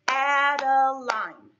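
Adeline chanted slow and loud in three beats; syllable by syllable. Shown as a demonstration. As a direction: clap out Adeline as A-de-line, stretched and loud. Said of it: "Let's try it again".

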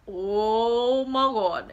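very slow, loud, drawn-out gasp of words; retold astonishment. Reliving her boyfriend's proposal, acted astonishment. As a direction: stretch it out in acted disbelief.

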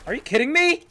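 high, loud disbelieving shout; sudden loud outraged cry; exasperated yell. Real, not acted. Outburst at game event.

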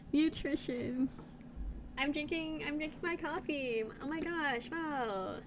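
put-on softened Valley Girl lilt. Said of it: "in a Valley Girl voice".

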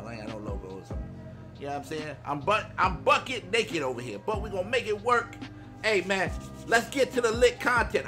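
usual speaking voice

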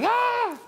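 high, loud startled shriek; mock scream. Lady startled by the preacher, high scream. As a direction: let out a sudden high "Ah!". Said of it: "she saw me and she screamed".

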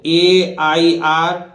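slowly spelling the letters. Shown as a demonstration. Teacher slowly spells letters for students. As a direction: draw out each letter for the learners. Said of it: "देखो".

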